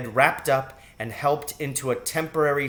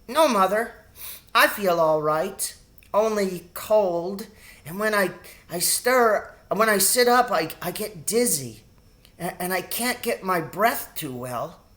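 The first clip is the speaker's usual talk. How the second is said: put-on higher, halting breathless child; sick boy stumbling over words; weak sick boy; 7 semitones higher than usual. A narrator's reading. Sick Timothy answering.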